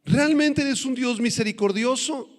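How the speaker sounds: doubting question. Acted. Believer questioning God in trials, doubting and high-pitched. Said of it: "en nuestras dudas, en nuestra carnalidad ... le digamos".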